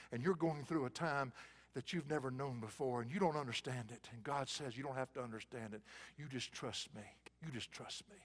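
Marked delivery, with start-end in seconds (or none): 4.8-5.8 soft, low reassurance; God reassuring a hurting listener, softly; God's gentle voice. Acted. God speaks tenderly. 6.2-7.0 low, soft and gentle; God comforting the hurting, soft; soft reassurance. Acted. God repeats tenderly. 7.4-8.2 much softer, hushed repeat; God's echo, fading to a hush; near whisper. Acted.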